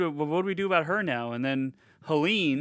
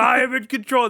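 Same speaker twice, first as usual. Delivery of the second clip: loud, high insistence; hammed-up indignant shout; acted desperate defiance; 9 semitones higher, 8 dB louder than usual. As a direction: imitate a doomed man's last protest. Voices doomed man's last protest.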